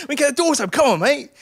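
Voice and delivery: high, loud acted pleading; urgent shouting. Passenger urging his mate at the doors, urgent yell. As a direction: yell it to rally a reluctant mate.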